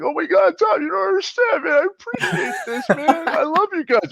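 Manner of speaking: high sobbing gratitude; crying with joy. Retold or imitated. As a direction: thank him through happy tears. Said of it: "and I was like".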